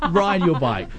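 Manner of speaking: hammed-up protest call through laughter; fiery revolutionary speech. Mock rallying call to cyclists.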